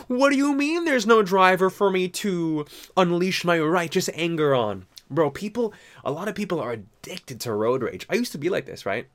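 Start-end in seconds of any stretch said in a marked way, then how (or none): 0.1-4.9 mock outrage. Voicing a raging driver.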